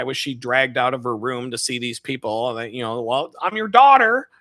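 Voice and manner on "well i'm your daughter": high, louder patient daughter; high loud daughter correcting her mother; daughter explaining. Retold or imitated. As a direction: remind her gently but loud.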